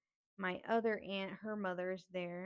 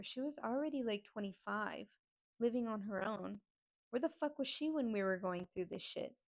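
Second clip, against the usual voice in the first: ordinary delivery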